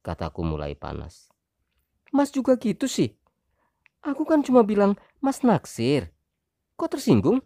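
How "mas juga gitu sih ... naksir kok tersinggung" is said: indignant wife. Acted. Wife turning the blame on husband, high indignant voice. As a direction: throw it back at him.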